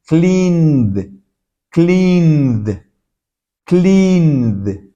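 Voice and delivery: "Cleaned" drawn out into a voiced final d. Shown as a demonstration. Teacher models for learners, "Cleaned" slowly with a voiced d ending. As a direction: stretch "Cleaned" as one syllable, "kleend". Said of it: "se pronuncia como una D".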